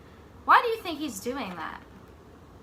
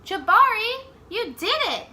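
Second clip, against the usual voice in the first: much higher and louder, cheering; dad cheering his son on; proud cheer; 11 semitones higher, 8 dB louder than usual. Acted. Dad cheering Jabari after the jump, excited. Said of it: "said his dad".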